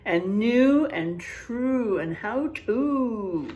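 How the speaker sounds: slow, stressed rhyming words; slow rhymes. Shown as a demonstration. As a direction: lay out each rhyme slowly. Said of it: "repeating the rhymes slowly".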